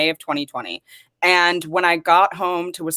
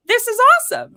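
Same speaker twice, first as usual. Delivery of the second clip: much higher, excited quoted reaction; retold thrill; faster than usual. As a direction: relive the delight of the invite.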